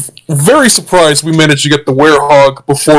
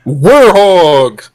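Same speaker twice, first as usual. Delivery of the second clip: slow, savoring "Werehog!" with relish; drawn-out excited exclaim; 2 semitones higher, much slower than usual. Real, not acted. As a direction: stretch out "Werehog!" gleefully. Excited echo of the name.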